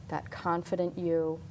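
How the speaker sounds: calm hypnosis voice; guided visualization. Acted.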